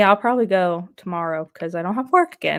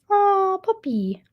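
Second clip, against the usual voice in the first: high, slow cooing at a puppy; 12 semitones higher, much slower than usual. Real, not acted.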